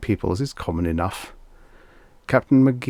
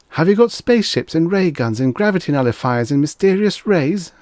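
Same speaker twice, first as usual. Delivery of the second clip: put-on high, loud excited child voice; a kid thrilled by ray guns; eagerly asking in a high boy voice; 10 semitones higher, 5 dB louder than usual. A narrator's reading. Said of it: "asked Tommy eagerly".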